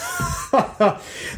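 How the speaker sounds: short, airy acted chuckle; acted laugh. King looks at the adventurer and laughs, dismissive chuckle. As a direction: laugh it off, mostly breath. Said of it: "and he just laughs".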